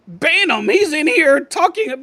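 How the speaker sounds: exaggerated, high-pitched tattling; mock outrage. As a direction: mimic someone complaining to the moderators. Mimicking mod-happy streamers complaining.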